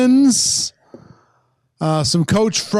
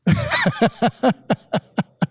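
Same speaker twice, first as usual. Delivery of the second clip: laughing; 6 semitones higher than usual. Real, not acted. Host laughs after doing an impression of a colleague, staccato laugh.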